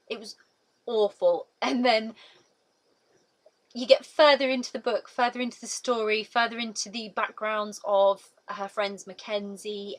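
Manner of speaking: normal talking voice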